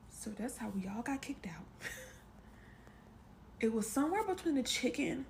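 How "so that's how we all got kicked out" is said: soft conspiratorial whisper; whispered punchline. Real, not acted. Storyteller whispering the punchline to viewers. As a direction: whisper the payoff to the camera.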